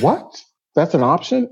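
high squeaky what, then rising question; mock disbelief. The couple answering Zan's advice, high hammed-up disbelief. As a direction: play it up as stunned. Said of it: "And we said".

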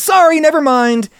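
high, loud hasty backing down; nervous, flustered retreat; panicked apology. Retold or imitated. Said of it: "everybody panics and says".

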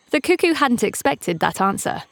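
ordinary delivery, nothing marked

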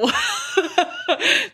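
high giggle that breaks out; high burst of laughter. Real, not acted.

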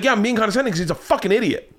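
acted annoyance without extra volume; retold exasperated retort.

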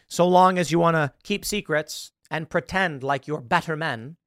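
ordinary talk, nothing marked

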